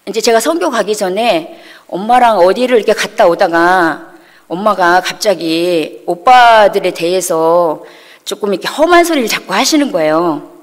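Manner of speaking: usual speaking voice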